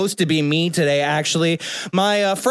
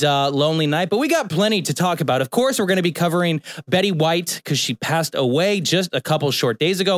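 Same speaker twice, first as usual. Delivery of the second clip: ordinary delivery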